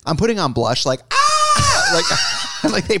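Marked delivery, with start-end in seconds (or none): 1.1-1.3 demo scream. Shown as a demonstration. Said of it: "I'm screaming in there".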